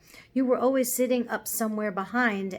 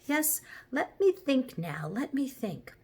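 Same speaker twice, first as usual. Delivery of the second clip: thoughtful; 5 semitones higher than usual. A narrator's reading. Horse trying to recall his past, thoughtful. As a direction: draw it out while searching memory. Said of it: "said the horse thoughtfully".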